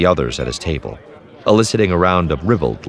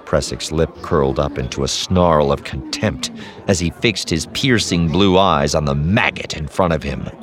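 ordinary delivery, nothing marked

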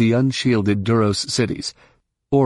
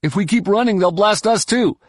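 high, urgent pilot's radio call; voice over comlink; 10 semitones higher than usual. A narrator's reading. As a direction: report in over the comm, pitched well up. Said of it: "a voice said out of Jaina's link box".

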